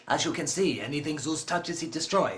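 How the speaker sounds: low, soft ominous announcer; deep movie trailer voice. Acted. Introducing Zeus the villain, deep and ominous.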